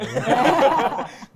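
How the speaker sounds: hearty laugh out loud. Real, not acted. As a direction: burst of laughter. Host laughs at joke.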